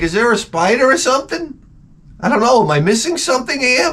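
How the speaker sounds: high-pitched, hammed-up worry; nervous searching. Spooked owner scanning the wall, hammed-up worry.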